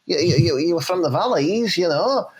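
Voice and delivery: ordinary delivery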